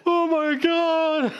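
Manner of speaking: high, slow drawn-out dismay; high slow hammed-up gasp of horror; mock dismay.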